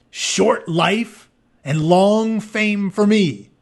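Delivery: slow, loud, defiant declaration; proud warrior vow, slow and loud; heroic vow. Acted. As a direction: choose glory like a young hero. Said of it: "said Achilles, fingering his sword".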